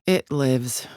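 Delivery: ordinary talk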